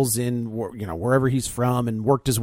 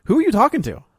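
confused partner; 7 semitones higher, much faster than usual. Pretend. Acting a confused partner's quote.

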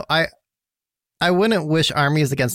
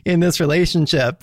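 laughing; 5 semitones higher, 5 dB louder than usual. Real, not acted. Laughing at Megan's label.

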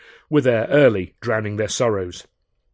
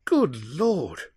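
high, dismayed exclamation; distraught; 6 semitones higher than usual. A narrator's reading. Charlie hears of Arthur's escape, distraught. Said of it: "Charlie was distraught".

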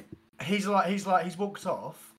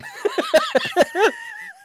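very high squealing laugh; helpless high-pitched laugh; high shriek of laughter; 5 dB louder than usual. Real, not acted. Cracking up mid-story.

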